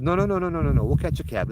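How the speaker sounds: mock-frantic brush-off; panicked refusal. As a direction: passengers nervously refusing the ride. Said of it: "they're like".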